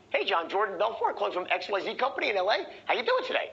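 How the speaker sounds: "Hey, John" and company name lilted upward, "How you doing today" high; declarative as question. Shown as a demonstration. Salesman cold-calling John, statements phrased as questions up to "today". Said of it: "Phrasing your declarative as a question".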